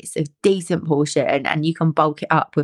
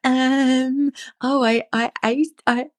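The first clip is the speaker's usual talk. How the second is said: higher, sheepish, with a laugh; sheepish confession; 9 semitones higher than usual. Retold or imitated. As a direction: own up guiltily. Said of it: "And I'm like".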